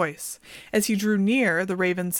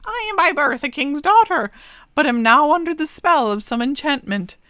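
higher, louder regal raven; a raven revealing she is a king's daughter; raven princess voice; 7 semitones higher, 7 dB louder than usual. Acted. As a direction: announce it like an enchanted princess. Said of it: "the raven called to him and said".

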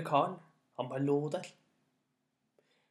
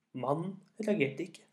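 ordinary delivery

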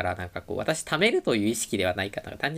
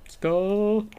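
excited; 12 semitones higher, 3 dB louder, much slower than usual. Real, not acted. Excited cheer for the moe voice.

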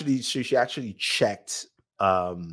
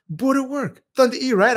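excited fan; 13 semitones higher than usual. Retold or imitated. Excited fan greeting him.

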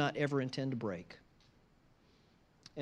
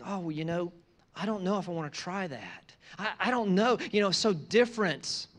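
mock whiny hesitation, pitched up; hesitant picky eater; 5 semitones higher, 5 dB louder than usual. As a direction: voice a doubtful eater, a bit higher.

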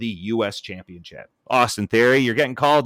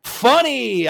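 high, loud taunting mock laugh; hammed-up high gloating voice; mocking Bayley; 13 semitones higher, 6 dB louder, slower than usual. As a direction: sneer at her as a smug prankster. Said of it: "where Bayley's like".

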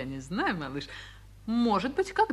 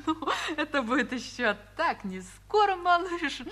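laughing; 8 semitones higher than usual. Acted. Mother laughs, answering son.

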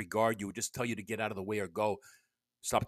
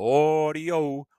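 loud drawn-out audio check call; 3 semitones higher, 7 dB louder, much slower than usual. Shown as a demonstration. Podcaster checking his audio, loud sound check call. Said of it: "audio check, audio check".

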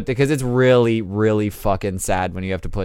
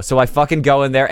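ordinary talk, nothing marked

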